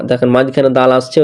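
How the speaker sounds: ordinary talk, nothing marked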